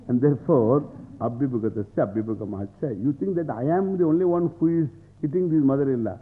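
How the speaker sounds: ordinary talk, nothing marked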